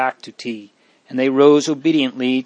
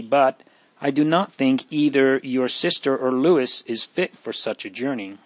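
plain reading voice

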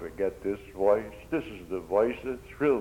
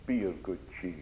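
soft, tender reassurance; sweet melodious voice; 3 semitones lower, 8 dB quieter than usual. Acted. Preacher voices Jesus comforting disciples, soft and tender. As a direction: speak it gently as a comforting Lord. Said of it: "this melodious voice".

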